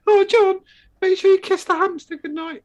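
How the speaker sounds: put-on high, loud little kid pleading; kids' voice. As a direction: squeak it like a small boy begging. Kids' pleading quote retold.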